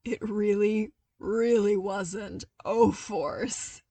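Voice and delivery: sputtering laugh. A narrator's reading. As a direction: agree while still sputtering with laughter. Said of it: "between sputters".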